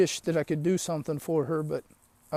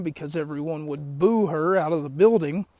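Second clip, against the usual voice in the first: ordinary talk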